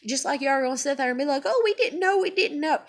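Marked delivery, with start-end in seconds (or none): 1.4-2.8 high, singsong mock excuse; mocking coworkers. Mimicking coworkers' excuse, mocking and high. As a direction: mimic them in a higher whiny pitch.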